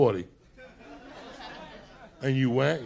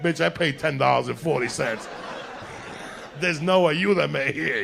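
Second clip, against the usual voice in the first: mock retort; 2 semitones higher, 6 dB louder than usual. Customer retorts to crying girl.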